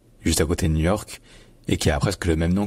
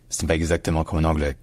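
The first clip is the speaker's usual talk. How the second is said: usual speaking voice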